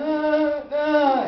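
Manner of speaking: high nervous stammer; flustered. Retold or imitated. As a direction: caught off guard and scared. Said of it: "So I'm like".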